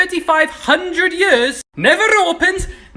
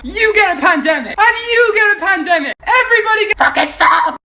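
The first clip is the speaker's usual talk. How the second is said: mock Oprah giveaway; 6 semitones higher than usual. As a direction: hand out pandemics like prizes. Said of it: "acting like Oprah".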